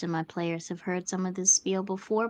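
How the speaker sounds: ordinary delivery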